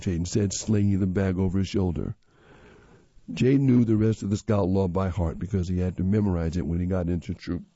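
ordinary delivery, nothing marked

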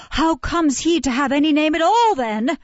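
high, loud astonished question. A narrator's reading. Nurse astonished at the orphan's name, high and loud. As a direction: ask in disbelief. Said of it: "raised her hands in astonishment".

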